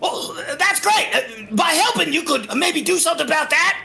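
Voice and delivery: high, hurried nudge for help; urgent kobold rogue. Acted. Game master plays kobold urging help.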